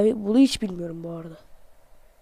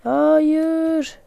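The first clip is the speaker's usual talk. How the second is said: dismayed no; 6 semitones higher, 4 dB louder than usual. Real, not acted. Dismayed at game moment.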